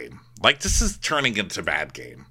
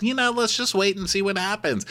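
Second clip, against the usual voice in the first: high mock voice; 13 semitones higher than usual. Voicing Corey high.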